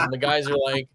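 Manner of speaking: ordinary talk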